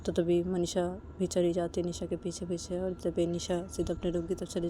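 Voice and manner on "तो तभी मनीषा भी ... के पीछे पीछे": plain reading voice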